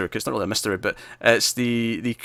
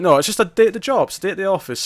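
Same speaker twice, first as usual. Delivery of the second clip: higher, breezy take on the casual pregnant detective; easygoing small-town cop; 8 semitones higher, 3 dB louder, faster than usual. Retold or imitated. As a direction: pitch it up like it's just another day at work. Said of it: "She's like".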